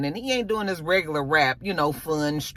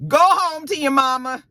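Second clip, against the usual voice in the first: higher, louder call at the screen; 8 semitones higher, 5 dB louder than usual. Retold or imitated. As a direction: shout the warning at the TV character. She yells at Fame on screen.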